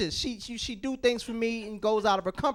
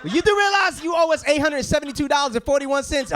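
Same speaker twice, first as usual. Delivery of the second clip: loud, higher angry collector; loud demanding; 6 semitones higher, 5 dB louder than usual. Pretend. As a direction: demand it loudly.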